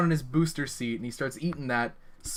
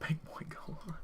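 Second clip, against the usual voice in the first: hushed, voiceless whisper; 4 semitones higher, 10 dB quieter than usual. Real, not acted. Game master echoing the player's idea, whispered. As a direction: whisper it under your breath.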